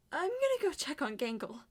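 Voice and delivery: normal talking voice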